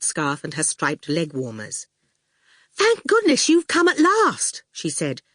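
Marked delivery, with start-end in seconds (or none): 2.7-4.6 high, anxious student voice; nervous Fifi. A narrator's reading. Student greets Howard anxiously, high nervous voice. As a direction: fret it out in a high voice.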